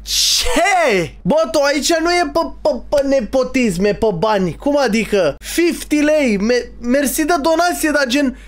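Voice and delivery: can't believe someone donated; stunned excitement. Real, not acted. Streamer reacting to a viewer donation, high excited disbelief.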